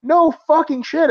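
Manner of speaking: high, slow, incredulous; exasperated. Real, not acted. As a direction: drag it out in disbelief. Exasperated reaction continues.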